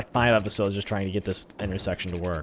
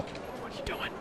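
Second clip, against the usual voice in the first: a hushed, baffled question to the game; whispered disbelief; 11 dB quieter than usual. Real, not acted. Scolding game twice, whisper then voice.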